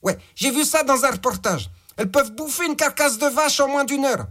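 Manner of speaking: boss voice. Acted. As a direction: bark orders like a crude crime boss. Boss gives orders by phone.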